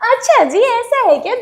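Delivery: high, sing-song sarcastic retort; mock sarcasm. Student mocking the teacher's demand, sing-song sarcastic. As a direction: toss it back with mock surprise.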